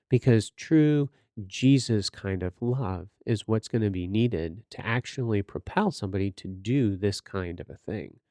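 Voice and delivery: normal talking voice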